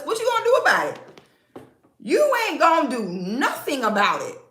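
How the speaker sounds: high, loud taunting dare; taunting challenge. Real, not acted. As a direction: goad them, loud and high. Taunting men in chat.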